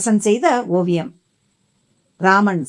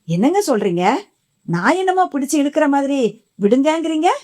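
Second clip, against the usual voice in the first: puzzled question rising high at the end; surprised; 7 semitones higher than usual. A narrator's reading. Wife asks husband why he said let go, surprised. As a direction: asks in disbelief, voice climbing. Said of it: "ஆச்சரியமாக கேட்டாள் ராதா".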